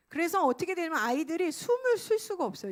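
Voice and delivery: ordinary talk, nothing marked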